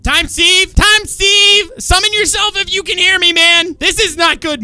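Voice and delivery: loud, high shouted calls; calling out. Acted. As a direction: shout into the distance for him. Calling out for missing friend.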